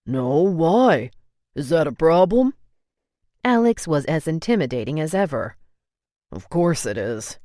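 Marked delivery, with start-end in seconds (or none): 0.1-2.5 put-on louder, challenging young man; Alex voice, defiant. A narrator's reading. 6.3-7.4 low, firm brother's voice; Alex voice, lower. A narrator's reading.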